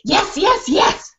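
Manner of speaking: high, rising yaps; excited dog voice; eager dog. Acted. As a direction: bark eagerly. Dog barking agreement.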